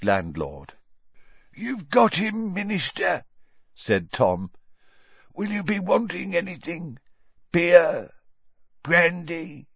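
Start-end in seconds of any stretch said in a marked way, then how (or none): 1.5-3.2 higher, slowish wizened innkeeper; a hint of a toothless old barman; lightly voiced old landlord. Old landlord Tom speaks. 5.3-9.7 higher, slowish wizened innkeeper; a hint of a toothless old barman; lightly voiced old landlord. Tom offers drinks.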